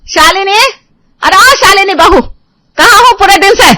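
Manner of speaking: high, loud shout across the house; mother-in-law yelling for Shalini; calling out. Acted. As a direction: holler for the bahu from another room.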